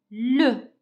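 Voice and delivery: "le" said loud and separate, French schwa sounded fully; pronunciation demo. Shown as a demonstration. Teacher joins L with E for pupils, "le" loud and distinct. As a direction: give "le" firmly as one full syllable. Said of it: "comment je prononce?".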